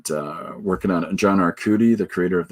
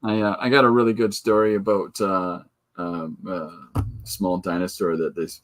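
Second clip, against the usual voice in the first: ordinary delivery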